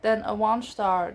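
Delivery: usual speaking voice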